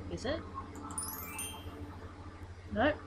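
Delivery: ordinary talk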